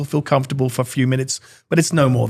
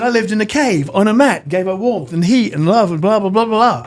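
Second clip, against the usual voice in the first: higher-pitched surfer rattling off a list; old surfer; 7 semitones higher than usual. Retold or imitated. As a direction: reel it off as the old surfer dude. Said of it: "looks like an old surfer".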